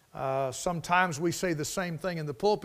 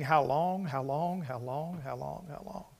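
each how long softer until almost gone; fading lament; 3 semitones lower, 6 dB quieter than usual. Retold or imitated. Psalmist crying out to the Lord, fading plea. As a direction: let the plea fade away. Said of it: "he's saying".

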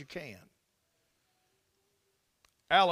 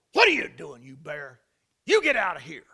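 retold holler; 5 semitones lower, 7 dB louder than usual. Fishing guide chasing off a grizzly, retold holler. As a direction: holler it like scaring off a bear. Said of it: "I went to hollering".

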